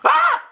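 high loud mock shriek; a sudden comic yelp of fright; mock scream. Preacher mimics people frightened of God, loud mock shriek.

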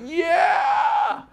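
very high mock cheer; a squealed high yeah from the room; high cartoon whoop. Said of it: "the room's going".

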